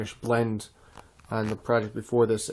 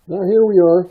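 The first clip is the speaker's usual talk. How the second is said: muffled; 5 semitones higher, 7 dB louder than usual. Real, not acted. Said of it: "excuse me if I sound a bit muffled".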